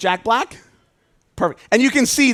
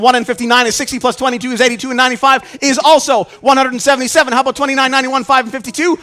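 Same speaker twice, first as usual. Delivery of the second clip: louder and higher with every sum, rising to a big finish; building showman excitement; 7 semitones higher, 6 dB louder than usual. Acted. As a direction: reel off the numbers like a triumphant showman, climbing to the climax. Rapid-fire magic square reveal finale.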